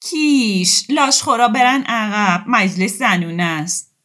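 loud, high shoo-away call; loud call chasing men off. Acted. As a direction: drive them back like vultures.

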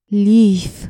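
loud, tight-lipped single word; through tight lips. A narrator's reading. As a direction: force it out through pressed lips.

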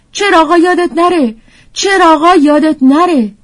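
high, loud voice of a tiny talking book; squeaky little storybook character; excited little book. A narrator's reading. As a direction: call out a high, urgent reminder while hopping up and down.

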